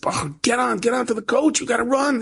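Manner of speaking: high, urgent imitation of a driver; urgent calling. Coach driver to a man left on the road, urgent calling. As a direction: urge him aboard, pitched right up.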